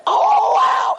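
sudden high yelp of pain; mock pain yelp, high and loud; retold scream. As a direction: cry out as the knife cuts. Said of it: "I gave out such a scream".

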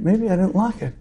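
acted second-guessing to oneself; self-doubt. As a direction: let a doubt creep in quietly. Man's doubt returns.

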